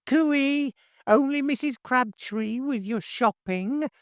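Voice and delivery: high, reedy busybody call; high reedy neighbour. A narrator's reading. Nosy neighbour calling out as she lets herself in, high and reedy. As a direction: sing out shrilly from the doorway. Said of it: "high and reedy".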